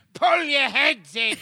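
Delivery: put-on high, slow scolding old lady voice; cranky old woman snapping. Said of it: "like, went".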